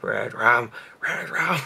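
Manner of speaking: creepy REDRUM, imitated with mischief; imitating little brother. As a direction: play a naughty kid gleefully chanting "red rum". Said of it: "he was like".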